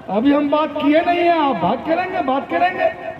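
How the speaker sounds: lightly voiced wife objecting on the phone; protesting wife. Wife refusing to let old mother-in-law talk, protesting. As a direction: cut in with rising indignation, mildly acted.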